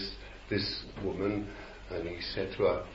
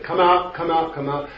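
mock exorcist command; 7 semitones higher, 7 dB louder than usual. Mocking exorcists repeating commands.

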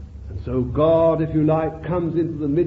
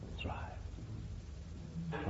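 whisper; 9 semitones lower, 25 dB quieter than usual. Real, not acted. Aside after climax.

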